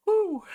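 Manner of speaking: high, loud whoop of laughter. Real, not acted. Bursting out after funny comment.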